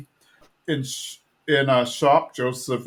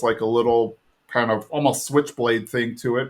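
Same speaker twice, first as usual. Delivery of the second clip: usual speaking voice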